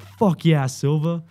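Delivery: high, loud hype from the platoon; hyped cheer. Retold or imitated. Platoon hyping up Silva, excited loud cheer. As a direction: cheer him on, loud and punchy. Recruits cheering him on in retold scene.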